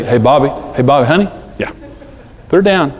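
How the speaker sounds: controlled parent, pitch kept near normal; calm, no yelling. Acted. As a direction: give the order evenly, without raising your voice. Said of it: "I don't yell".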